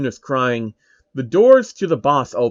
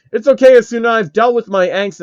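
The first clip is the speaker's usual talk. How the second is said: higher and louder, proud Kirito voice; heroic entrance; 8 semitones higher, 6 dB louder than usual. Acted. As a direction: burst in announcing you've grown. Kirito bursts into the room.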